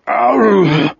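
high, heaving moan; retching groan; sick moan. A narrator's reading. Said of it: "Wolf moaned and vomited".

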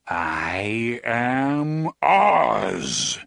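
booming, slow and far-off god voice; thundering voice. A narrator's reading. The wizard answers the travelers, thundering. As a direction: let it roll out huge and slow. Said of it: "the voice thundered".

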